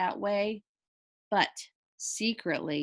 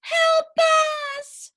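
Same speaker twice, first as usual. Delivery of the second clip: high, loud cry for help; calling for help; 8 dB louder than usual. A narrator's reading. Wife calling from the sunken bed, desperate call for help. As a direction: cry out from the hole. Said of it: "Missus Watson called".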